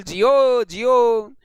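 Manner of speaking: loud, hammed-up cheer of praise; mock blessing. Teacher mock-praising Dimple for a wrong answer, loud hammed-up cheer.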